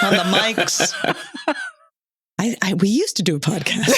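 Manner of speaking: words broken up by laughter; cracking up. Real, not acted. Host jokes about forgetting how to podcast, cracking up. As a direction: try to talk through the giggles. Cracking up over rusty mic skills.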